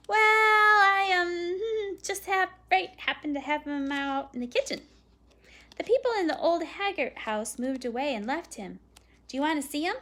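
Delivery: put-on boy hedging, then easing into his pitch; hesitant boy. A narrator's reading. Boy admitting the dog is in the kitchen, hesitant boy.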